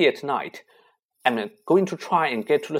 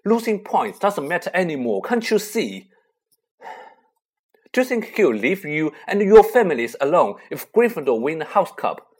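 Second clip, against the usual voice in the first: higher and louder, urgent and fierce; impassioned outburst; 7 semitones higher, 9 dB louder than usual. A narrator's reading. Harry insisting to his friends he must go: fierce outburst. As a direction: pour out desperate anger.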